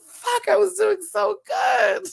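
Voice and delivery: high, slow tearful wail; crying. Real, not acted. Host failing to stay composed, high tearful cry. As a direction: wail in frustration at breaking down. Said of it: "I'm gonna cry again".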